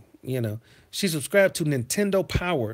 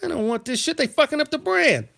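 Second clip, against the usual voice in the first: higher, louder indignant rant about the gift; heated, pitched-up complaint; 9 semitones higher, 3 dB louder than usual. Retold or imitated. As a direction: vent it like a wronged kid. Ranting about unwanted Christmas game.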